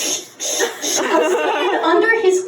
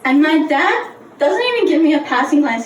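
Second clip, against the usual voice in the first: usual speaking voice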